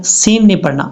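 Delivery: ordinary talk, nothing marked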